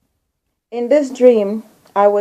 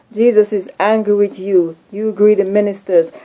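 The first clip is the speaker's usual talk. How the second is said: usual speaking voice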